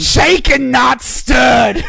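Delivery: loud hammy catchphrase; shouted Bond line. Pretend. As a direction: belt out the spy catchphrase.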